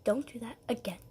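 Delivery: whispered, prim correction; hushed scolding. Retold or imitated. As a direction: softly scold him like a teacher. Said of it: "my stepmom would be like".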